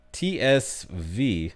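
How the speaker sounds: ordinary talk, nothing marked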